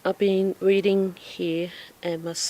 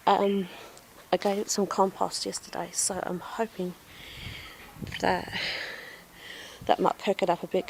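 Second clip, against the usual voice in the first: ordinary talk, nothing marked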